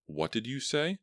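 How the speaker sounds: high, startled question; can't believe she heard. A narrator's reading.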